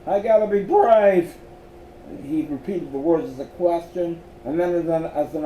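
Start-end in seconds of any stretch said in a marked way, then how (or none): 0.1-1.4 raised, loud nervous pep talk; uneasy self-encouragement. A narrator's reading. Miles tells himself repeatedly, uneasy forced bravery. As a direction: push it out high and loud, uneasily. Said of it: "repeated uneasily and told himself repeatedly".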